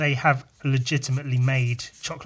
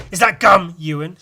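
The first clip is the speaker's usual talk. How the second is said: loud, higher, sharp question; accusing teacher; 4 semitones higher, 10 dB louder than usual. Retold or imitated. Teacher accusing a pupil of chewing gum, loud. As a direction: bark the question at the boy. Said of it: "she said".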